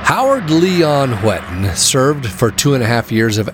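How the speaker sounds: calm, measured storyteller voice; documentary narration. A narrator's reading. Documentary narration over music.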